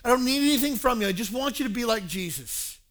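high, loud appeal; impassioned insistence. Retold or imitated. Paul urging church to be like Jesus, impassioned. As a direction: drive the appeal home as Paul.

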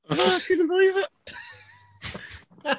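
high, slow words squeezed out through laughter; laughing disbelief. Real, not acted. Telling mates the punchline, laughing, slow and high. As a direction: still helpless with laughter from his own story.

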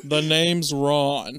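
slow, drawn-out name intro; a hammed-up slow self-introduction; mock introduction. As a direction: say your name like a movie spy. Mummy introduces himself, slow.

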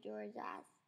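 usual speaking voice

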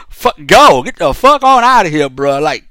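ordinary talk

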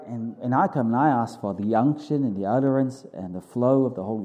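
fervent, earnest pastor's prayer. Acted. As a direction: pray it out boldly to God. Leads the congregation in prayer.

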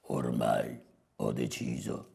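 very low, soft creaky growl; rough, hushed boss pronouncement; hornet boss. Acted. Voices Calabrone deciding.

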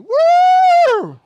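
long, piercing whooping neigh; whinny loudly with overflowing joy; joyful horse whinny. Shown as a demonstration. The Lord happy to see you, loud high whinny. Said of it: "rejoicing over you like a horse".

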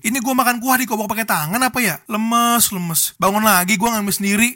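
ordinary talk, nothing marked

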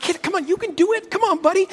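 high, fast, urging the dog on; eager coaxing. Retold or imitated. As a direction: cheer the dog on like a hopeful owner. Wife urging dog to unlock car.

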